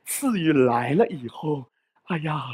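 usual speaking voice

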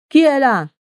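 loud high call from the dark. A narrator's reading. A guard by the ditch calls out loudly. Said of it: "disse in quel momento qualcuno".

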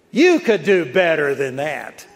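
mocking jab at a tired runner; sarcastic taunt. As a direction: needle him sarcastically. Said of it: "and real sarcastically".

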